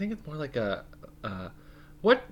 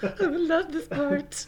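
much higher, slow drawn-out sarcasm; sarcastic; much slower than usual. Acted. Co-host teasing the mom's-lap story. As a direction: savor it mockingly.

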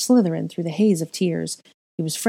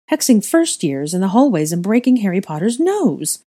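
raised, louder accusation; indignant cry; 4 semitones higher, 6 dB louder than usual. A narrator's reading. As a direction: reel off the offenses indignantly. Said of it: "Helga cried".

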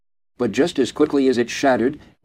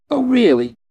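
much higher, louder mocking "Oh, really?"; sneering; 5 semitones higher, 4 dB louder than usual. A narrator's reading. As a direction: scoff at his claim. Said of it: "Abby sneered".

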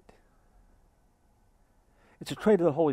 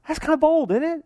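rhetorical high pitch; 10 semitones higher, 3 dB louder than usual. Real, not acted. Rhetorical emphasis.

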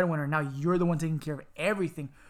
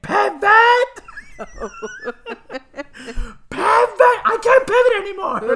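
much higher, louder, frantic shouting of Pivot; Ross from Friends; 10 dB louder than usual. Retold or imitated. Quoting Friends couch scene, frantic shouted pivot. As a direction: yell it like a frazzled sitcom mover. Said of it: "that's from Friends, where he's like".

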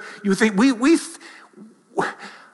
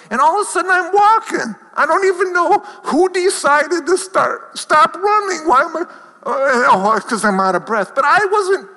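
much higher and louder, fast, hammed-up panic; mock breathless bewilderment; 13 semitones higher, 6 dB louder than usual. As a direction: replay the run in a higher, louder, winded voice. Retelling his run, out of breath.

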